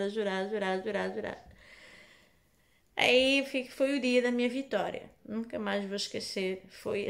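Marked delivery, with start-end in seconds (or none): none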